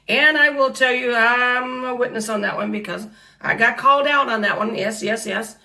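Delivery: loud, high testifying; loud testimony. Real, not acted. Preacher testifying to the congregation, loudly. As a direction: proclaim it to the room.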